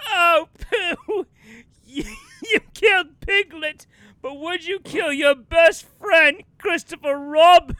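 high, loud pleading declamation; melodramatic actor. Acted. Actor pleading with the killer bear, melodramatic. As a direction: plead like a ham tragedian, breaking into laughs. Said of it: "he'll be like".

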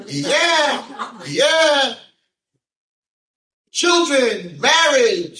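high, loud hammed-up promise; exuberant blessing. Rabbi promising children and marriage, exuberant high shout. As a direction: imitate a rabbi whooping out blessings.

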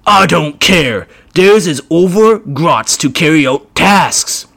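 a brutish orc taking his time. Acted. Orc voiced.

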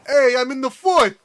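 high, slow Brooklyn dude; hammy Brooklyn tough guy; Brooklyn accent. Retold or imitated. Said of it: "just a Brooklyn, Brooklyn dude".

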